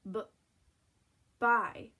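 isolated "b" sound, pause, then blended "buy". Shown as a demonstration. Teacher sounding out B before Y, segmented then blended. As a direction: split off "b" before saying "buy" whole. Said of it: "try and sound it out".

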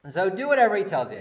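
loud, quick motherly order; a mother insisting on her way; bossy mother. Acted. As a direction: step right over his boundary, loud. Repeating Mary's command.

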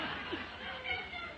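a high, soft laugh trailing off. Real, not acted. Preacher laughing with the congregation at the bear story. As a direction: let a real laugh escape up high. Real laugh after the punchline.

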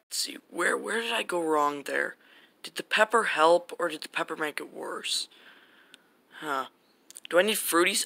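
quiet self-talk while tinkering; muttering to himself. Acted. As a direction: think aloud under your breath. Scientist muttering to himself alone.

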